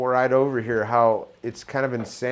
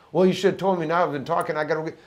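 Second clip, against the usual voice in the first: annoyed complaint; 7 semitones higher, 4 dB louder, faster than usual. Retold or imitated. Acted quarrel over cut connection.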